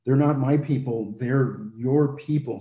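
"not" and "your" stressed, second half slowed. Retold or imitated. Moses pushing the people back to the Lord, stressing "your". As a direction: lean on "not my" then "your". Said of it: "and says, you know".

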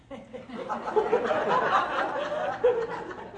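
real high-pitched giggling laugh; helpless high laugh; high burst of laughter. Real, not acted. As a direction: break up laughing, high and long. Laughing at granddaughter's fake crying.

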